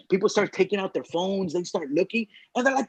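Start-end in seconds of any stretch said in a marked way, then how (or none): none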